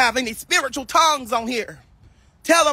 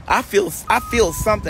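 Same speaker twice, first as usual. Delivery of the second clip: normal talking voice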